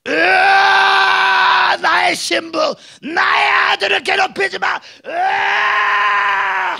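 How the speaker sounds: very loud, high, drawn-out roar; roaring lion. Acted. Lion of Judah roaring to protect his bride, shouting.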